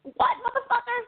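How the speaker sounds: high mocking taunt; mock angry yell. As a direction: spit it out in mock outrage. Player mocking streamer.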